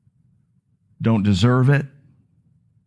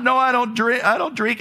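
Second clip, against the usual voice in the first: laughing through his own retold answer, pitched higher; 11 semitones higher than usual. Preacher retelling his refusal to the waiter, laughing. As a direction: chuckle out the refusal.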